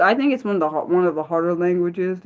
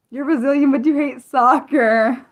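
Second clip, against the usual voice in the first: laughing; 7 semitones higher than usual. Real, not acted. Teasing laugh.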